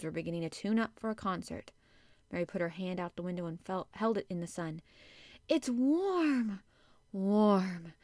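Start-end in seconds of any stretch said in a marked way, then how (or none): 5.5-7.9 soaring, then settling exclamation; delighted wonder. A narrator's reading. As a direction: savour each word with delight. Girl delighted by spring sun.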